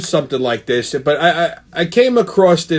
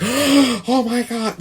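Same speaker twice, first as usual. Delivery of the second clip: shocked bystanders; 7 semitones higher, much slower than usual. Retold or imitated. Everyone shocked by confrontation.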